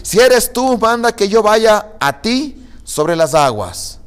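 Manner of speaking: high, loud call across the water. A narrator's reading. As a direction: Peter calling to his Lord, slow and projected. Reads Peter calling out to Jesus. (llamando en voz alta y aguda)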